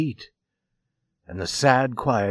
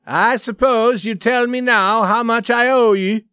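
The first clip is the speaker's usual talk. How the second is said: loud, higher, mocking demand; 10 semitones higher, 6 dB louder than usual. A narrator's reading. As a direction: throw the question at him. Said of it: "he said loudly to Scully".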